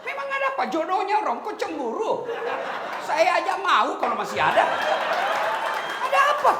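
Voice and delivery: high, loud, giggly busybody; mocking gossip. Preacher mimicking jealous gossipers, high and laughing. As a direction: squeal it through laughter like a gossip.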